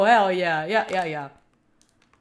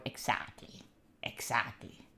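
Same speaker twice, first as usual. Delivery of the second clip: whisper; 17 dB quieter than usual. Acted. Whispered agreement to chat.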